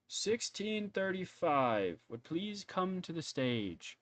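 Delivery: high, slow ceremonial announcement; Lord Kirschwood. Acted. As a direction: read out the winners, stretching every word. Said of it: "he pulls out a piece of paper and he goes".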